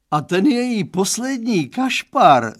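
high, loud wail of complaint; sudden howl. A narrator's reading. Father about daughter's last boyfriend, howling suddenly. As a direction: burst out howling. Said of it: "zavil tak z nenadání".

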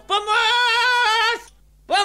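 high, loud cry for help; man at the door screaming for help. Retold or imitated. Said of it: "začne ten chlapík křičet o pomoc".

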